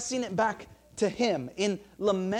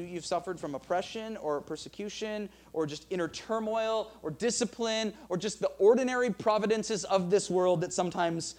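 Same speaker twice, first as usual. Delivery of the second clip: ordinary delivery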